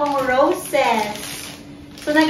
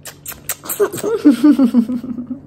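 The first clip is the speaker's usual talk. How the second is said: laugh. Real, not acted. Laughing with baby.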